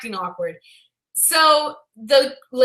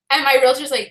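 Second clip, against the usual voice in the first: ordinary delivery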